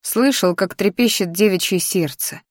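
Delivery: ordinary delivery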